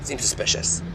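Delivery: hushed whisper so the van won't hear; spying from cover, whispering. Acted. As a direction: keep it secret and breathy-low. Hiding from a suspicious van.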